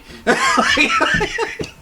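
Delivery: high-pitched burst of laughter; squealing laugh at the joke. Real, not acted. Friend hearing the story, high laugh.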